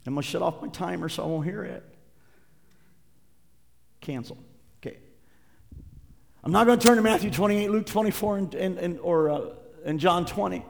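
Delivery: ordinary delivery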